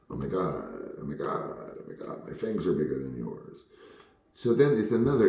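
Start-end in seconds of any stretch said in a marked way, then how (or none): 0.1-3.6 half-hearted, low-key mock boast; quiet mock boast. Two child actors silently sizing each other up, quiet mock boast. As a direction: softly imitate a rival's inner bragging. Mock rivals' inner thoughts.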